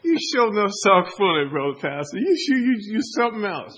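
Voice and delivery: mock amused reply. Acted amused reply to the warning.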